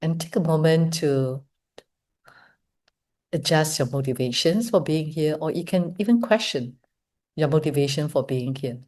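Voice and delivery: soft, unhurried meditation voice with long pauses; hushed, measured invitation to reflect; guided meditation. Real, not acted. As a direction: guide them gently inward, leaving space between phrases. Leads guided meditation after the talk.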